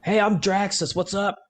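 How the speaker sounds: high, breezy friendly hello; cheery greeting. Acted. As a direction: play it casual and chummy.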